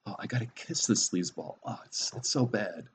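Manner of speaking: quietly voiced mock complaint; complaining. Woman complains to the gals about kissing her co-star, mock complaining. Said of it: "she would complain to the gals".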